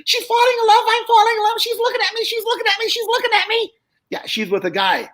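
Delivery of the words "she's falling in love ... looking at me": high squeaky dummy voice; excited little puppet voice, pitched way up; squeaky ventriloquist dummy. Retold or imitated. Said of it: "Joe would say".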